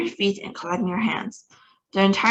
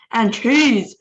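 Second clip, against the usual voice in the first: louder triumphant shout; 3 semitones higher, 6 dB louder than usual. A narrator's reading. Grand High Witch adding the final touch, loud shout. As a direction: crow it out. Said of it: "shouted the Grand High Witch".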